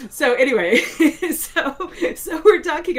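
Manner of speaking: high and loud, laughing through the words. Real, not acted. To the host, laughing while picking the story back up. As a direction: giggle your way back into the story.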